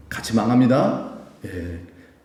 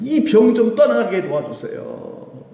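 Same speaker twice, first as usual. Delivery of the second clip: desperate plea; 10 semitones higher, slower than usual. Retold or imitated. Paul's desperate prayer retold.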